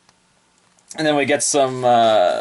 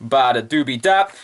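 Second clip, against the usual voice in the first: ordinary talk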